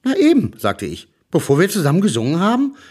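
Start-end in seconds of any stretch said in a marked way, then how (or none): 0.0-0.5 put-on much higher child voice. A narrator's reading. Kind antwortet. 1.3-2.8 put-on higher, eager child voice. A narrator's reading. Kind antwortet.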